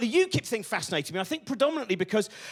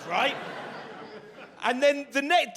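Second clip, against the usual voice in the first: ordinary talk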